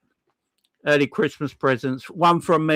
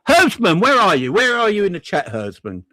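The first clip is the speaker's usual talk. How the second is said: loud, high call into the chat; 6 semitones higher, 5 dB louder than usual. Real, not acted. Streamer calling out to the generous donor in chat, loud and high. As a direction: shout for him to show himself.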